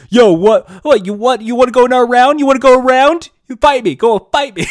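loud, high, picking a bar fight; slurred challenge to step outside; drunk brawler. Pretend. Drunk tough guy daring someone to fight, loud and high. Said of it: "that drunk fighting spirit".

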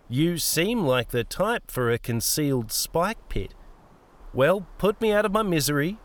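put-on sardonic old woman. Old woman presses Peter for the answer, sardonic. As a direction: toy with him, lifting at the end.

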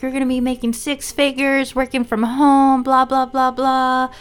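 salesy pitch voice. Retold or imitated. Friend pitching a sales job.

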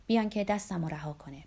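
plain reading voice

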